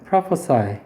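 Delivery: prophesy said slowly, ending in a clear "sigh"; teacher models prophesy with a long sigh ending; prophesy pronounced. Shown as a demonstration. Teacher modeling the verb for students, slow careful pronunciation. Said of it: "얘는 어떻게 발음하냐면".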